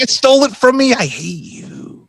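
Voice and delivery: high-pitched playful accusation, slowing at the end; mock outrage. Co-host teasing another for stealing the word, mock outraged.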